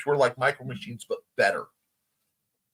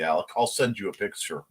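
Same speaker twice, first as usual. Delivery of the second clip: usual speaking voice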